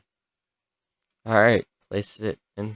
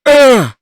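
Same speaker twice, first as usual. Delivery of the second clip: disgusted groan; 13 semitones higher, 16 dB louder than usual. Real, not acted. Gory surgery game reaction.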